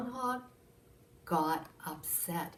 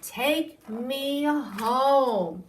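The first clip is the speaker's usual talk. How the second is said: slow, higher, louder grand command; groundhog to the taxi; 5 semitones higher, 6 dB louder, much slower than usual. Acted. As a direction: drawl it out like a star going home. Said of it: "and he said".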